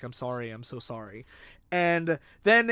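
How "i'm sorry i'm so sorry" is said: quiet apology. Retold or imitated. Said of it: "holding each other".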